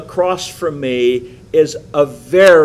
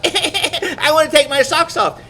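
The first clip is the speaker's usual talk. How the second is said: put-on high nagging child's whine through laughter; laughing mock child's whine, much higher; whiny little girl; 14 semitones higher, 3 dB louder than usual. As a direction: whine it like a stubborn four-year-old. Mimicking whining child.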